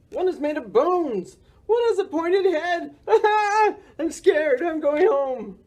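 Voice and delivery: high-pitched, scared little character voice; frightened cry. A narrator's reading. Morris frightened by children in costumes, high panicky cry. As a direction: squeal it in storybook panic. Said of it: "cried Morris".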